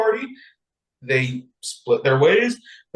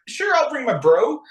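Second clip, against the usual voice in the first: bro king; 12 semitones higher than usual. Retold or imitated. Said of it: "Ahasuerus was like".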